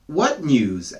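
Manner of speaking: ordinary delivery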